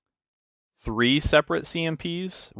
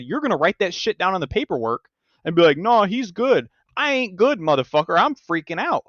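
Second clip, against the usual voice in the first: retold outburst; 9 semitones higher, 3 dB louder than usual. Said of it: "I just started tripping on him".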